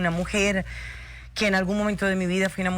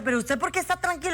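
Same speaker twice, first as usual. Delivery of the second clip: frantic question; 8 semitones higher, faster than usual. Retold or imitated. Panicked question to partner.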